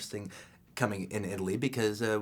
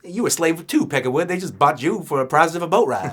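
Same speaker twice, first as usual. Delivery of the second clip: loud Django voice; 8 semitones higher, 12 dB louder than usual. Retold or imitated.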